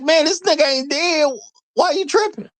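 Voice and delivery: high shrugging mock impression; mock careless woman. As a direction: toss it off like nobody's hurt. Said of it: "she literally treated them like".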